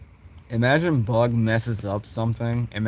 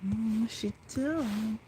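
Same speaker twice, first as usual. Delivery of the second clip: very high, slow, much softer; bewildered squeak; 13 semitones higher, 10 dB quieter, much slower than usual. Acted. Bug watching Vessel, quietly baffled. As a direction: puzzle it out in a tiny confused voice.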